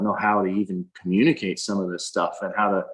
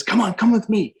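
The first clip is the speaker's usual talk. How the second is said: high, eager call to follow; excited invitation; 11 semitones higher, 4 dB louder than usual. Retold or imitated. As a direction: pull them along, bursting with excitement. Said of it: "jumps up all excited".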